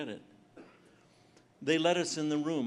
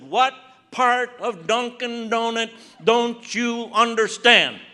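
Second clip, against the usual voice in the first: loud, slow, high exasperated yell; exasperated retort; 7 semitones higher, 12 dB louder, slower than usual. Retold or imitated. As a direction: spell it out like she's dense, shouting.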